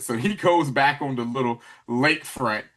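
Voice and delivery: usual speaking voice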